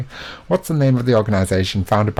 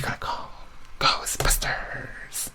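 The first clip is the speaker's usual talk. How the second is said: hushed whisper, very slow on the last word; 9 dB quieter, slower than usual. Acted. Quiz host whispering the answer as a tease. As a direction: whisper it like a secret.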